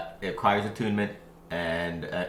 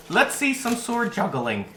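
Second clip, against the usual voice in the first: slow, very high fairy voice; 14 semitones higher, 3 dB louder than usual. Acted. Fairies ordering up a new trick, high and slow. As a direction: draw it out high as a demanding fairy. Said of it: "They go".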